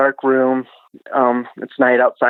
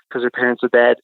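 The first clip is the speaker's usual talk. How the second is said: usual speaking voice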